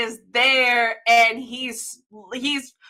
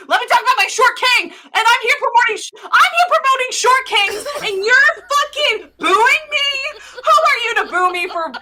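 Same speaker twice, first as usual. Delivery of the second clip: high, loud, fast exasperated rant; indignant squeal at the hecklers; mock outrage; 10 semitones higher, 3 dB louder than usual. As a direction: play it as a wronged presenter losing it. Mock outrage at being booed.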